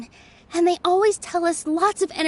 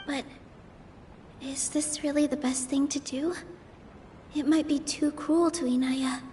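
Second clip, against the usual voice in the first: hushed inner thoughts; 3 semitones lower, 8 dB quieter than usual. Acted. Private thoughts before the debate.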